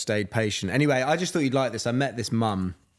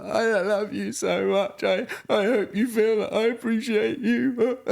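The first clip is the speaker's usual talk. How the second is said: much higher, slow, gushing mock tearful voice with a laugh; imitating a teary wife; 11 semitones higher than usual. Husband imitating his tearful wife hugging him, much higher and slow. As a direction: play a wife overcome after a weepy film. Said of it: "she was like".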